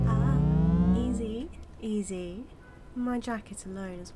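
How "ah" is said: loud, low yelp; sudden loud "ah" of protest; pained cry. Acted. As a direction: cry out as you're grabbed. Villain roughly grabbed by hero.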